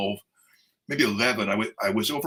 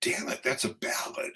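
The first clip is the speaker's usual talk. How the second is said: whispered aside; 7 dB quieter than usual. Retold or imitated. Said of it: "I said".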